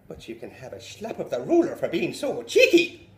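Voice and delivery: loud scolding teacher. Retold or imitated. Teacher threatening the cheeky boy, loud. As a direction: bark the threat.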